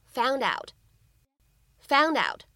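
"found out" linked smoothly as "foun-dout"; linking. Shown as a demonstration. Teacher showing students the linked "found out". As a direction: glide the d of "found" into "out", slowly. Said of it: "我们呢可以读成".

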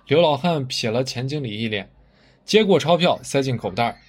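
ordinary delivery

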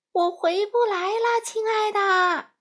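high, loud, drawn-out call; calling back. A narrator's reading. Oz calls down from the basket to Dorothy, loud and slow. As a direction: call down slowly from the sky. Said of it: "奥兹在篮子里喊道".

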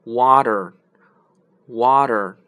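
water very slow with a flapped d-like t; slow American water, t turns to d; teacher imitating American water; American accent. Shown as a demonstration. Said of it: "美国人是".

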